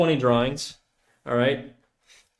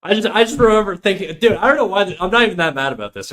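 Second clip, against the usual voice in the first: higher, agitated protest; triggered; 6 semitones higher, 3 dB louder, faster than usual. Real, not acted. Streamer insisting he isn't mad, sounding triggered. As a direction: protest heatedly that it doesn't bother you. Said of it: "You sound pretty triggered?".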